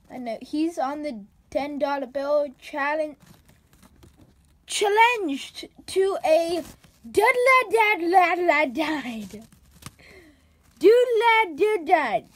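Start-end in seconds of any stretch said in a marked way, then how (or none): 4.7-12.3 slow, loud nonsense syllables; garbled mock reading. Teacher mocking a student's unreadable handwriting, loud gibberish. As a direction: babble the unreadable words aloud. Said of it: "reading illegible handwriting".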